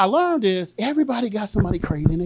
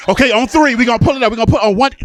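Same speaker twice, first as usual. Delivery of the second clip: loud, higher count-in to a partner; calling out; 4 semitones higher, 6 dB louder, faster than usual. Acted. Kid to the other back-seat passenger on lifting the seat, calling out. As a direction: call it out to lift a seat together. Acts out car passengers coordinating.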